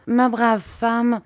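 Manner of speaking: gracious smile. Acted. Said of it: "d'un gracieux sourire".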